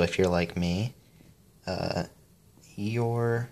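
ordinary delivery

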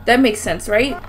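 ordinary talk, nothing marked

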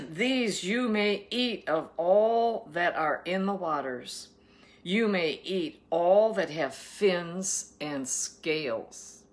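slow, emphatic proclamation of scripture; scripture reading. Acted. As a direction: read it out deliberately, leaning on key words. Reads scripture aloud.

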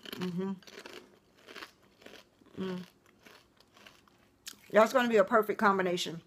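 speaking around food; chewing while talking. Real, not acted. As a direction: mouth partly full. Seen 1: Chip eaten earlier; closed lips and working jaw while speaking.

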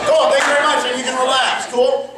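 loud, high call over the room; calling time. Real, not acted. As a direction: call off the exercise above the chatter. Coach calls over noisy group.

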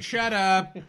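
high, loud jokey dismissal; mock outrage. Guest mock-heckling the English host, mock outrage. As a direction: cut in loudly with pretend indignation.

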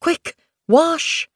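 high, fussing flustered mother cat. Acted. Moonflower fussing at dusty Bluekit, high and flustered. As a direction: fret over the messy kit. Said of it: "She sounded flustered".

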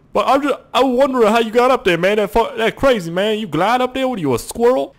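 high, loud put-on voice; incredulous game bystander. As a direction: play an astonished onlooker calling up.

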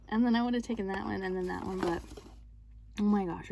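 ordinary talk

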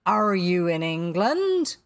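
loud, slow probing question; quizzing captain. A narrator's reading. Captain guesses at stranger's identity, probing. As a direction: boom the guess, stretching it out.

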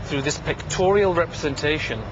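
ordinary talk

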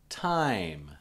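TIME drawn out, long I glide; going slow. Shown as a demonstration. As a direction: stretch the vowel in TIME for learners. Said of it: "I'm going slow".

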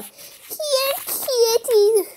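high sing-out cat call. Acted. Calling cat in story.

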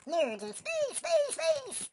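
high call leaping into squeaky echoes; silly falsetto cry. Pretend. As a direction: sing out SPACE in falsetto. Silly announcement in game.

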